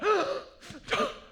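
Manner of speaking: high, loud horrified gasp; shocked gasp. Retold or imitated. Snob gasping at someone asking for ice, high and loud. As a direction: gasp as if it's sacrilege.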